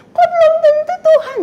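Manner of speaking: high, loud fearful question; high scared childish protest; frightened child. Retold or imitated. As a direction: ask in childlike panic. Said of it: "Masih ketakutan".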